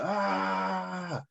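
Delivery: half-voiced high wail; mock pained yell. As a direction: imitate a drawn-out yell at restrained volume. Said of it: "yells out".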